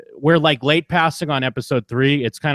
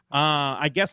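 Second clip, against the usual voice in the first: usual speaking voice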